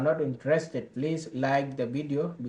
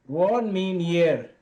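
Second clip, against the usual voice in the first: slow, higher and louder, word by word; slow teaching emphasis; 4 semitones higher, 5 dB louder, slower than usual. Shown as a demonstration. Teacher glossing a Dinka word for viewers, slow and emphatic. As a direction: spell out the meaning slowly for learners. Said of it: "teacher explains a word".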